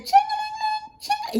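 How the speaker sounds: a high, sing-song "ching-a-ling-ling" like a ringing phone; mock phone ring. Imitating phone ringing on call.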